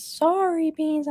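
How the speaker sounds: mild high-pitched impression of her husband; lightly voiced sweet apology; a hint of a meek husband saying sorry; imitating husband. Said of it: "He goes".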